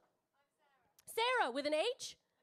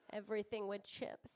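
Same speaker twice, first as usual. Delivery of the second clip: unvoiced, hushed aside; conspiratorial whisper about chips; 5 semitones lower, 6 dB quieter than usual. Real, not acted. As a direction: whisper it like a secret. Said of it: "whispered aside".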